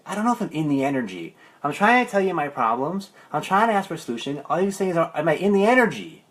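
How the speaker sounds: a fed-up client losing patience; frustrated. Retold or imitated. Bobby West fed up with the coach's one question, exasperated. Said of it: "Bobby West was getting really frustrated".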